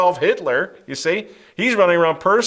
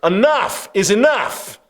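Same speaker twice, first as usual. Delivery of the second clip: higher, slow and firm; defiant refusal; 6 semitones higher, 4 dB louder, slower than usual. Retold or imitated. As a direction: plant your feet and refuse, slowly. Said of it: "They're like".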